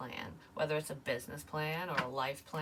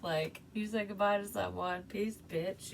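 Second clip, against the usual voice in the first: higher-pitched gloating gossip voice; mocking laughter; 5 semitones higher, slower than usual. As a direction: imitate people laughing behind someone's back. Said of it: "people could have been laughing about it".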